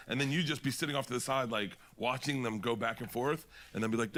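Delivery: normal talking voice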